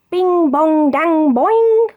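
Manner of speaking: high, loud bing bong dang boing; clanging sound words. A narrator's reading. Narrator sounding out the car hitting a hole, high and loud. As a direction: ring out the car's clatter like a bell.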